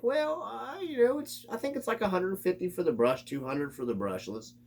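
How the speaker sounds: high, hesitant mocking voice; mocking a hesitant buyer. Mocking a clueless buyer at the hobby store, high and hesitant. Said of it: "you're just like".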